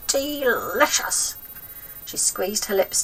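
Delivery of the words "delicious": slow, drawn-out savouring; old hag relishing a slug, slow and lip-smacking; relishing delicious. A narrator's reading. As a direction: savour the word slowly like a greedy hag. Grandma relishing slugs.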